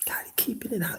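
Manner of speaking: very soft, voiceless whisper. Real, not acted. Host telling couples to keep arguments at home, whispered. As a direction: drop to a hushed whisper. Hushed advice between loud points.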